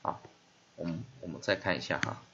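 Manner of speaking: ordinary talk, nothing marked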